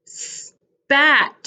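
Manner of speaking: SPAT begun with a long s, then a gap; slow sounded-out s...pat; isolated S. Shown as a demonstration. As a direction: hold the s before the rest of Spat. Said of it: "starts with the s sound".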